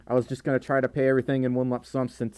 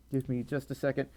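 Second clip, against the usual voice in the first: ordinary talk, nothing marked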